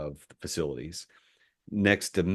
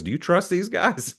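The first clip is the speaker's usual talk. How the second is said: quoted VP question pitched well up; voicing the VP's pointed question, pitched high; mock high question; 11 semitones higher than usual. The VP asks the CFO, high and pointed.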